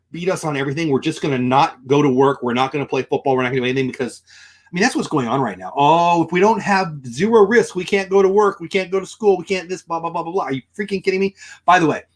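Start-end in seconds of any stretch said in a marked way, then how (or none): 5.8-10.4 mocking whine. Mocking the fearful crowd.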